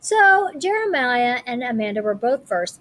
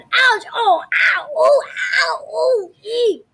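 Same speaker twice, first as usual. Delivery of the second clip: high yelps of pain, much higher; yelping in pain; 11 semitones higher, 4 dB louder, slower than usual. Acted. Girl hurt after kicking the blocks, high yelps. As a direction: cry out after stubbing a foot. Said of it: "Amanda yelled".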